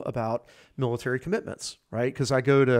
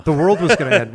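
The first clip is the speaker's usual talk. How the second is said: emphatic doomsday cry, louder and higher; loud exclamation; 4 semitones higher, 11 dB louder than usual. Real, not acted. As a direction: insist loudly over the other person. Repeats it over the co-host.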